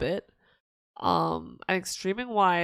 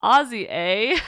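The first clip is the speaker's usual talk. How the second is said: put-on Aussie accent; 6 dB louder, slower than usual. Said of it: "the Osakan accent and".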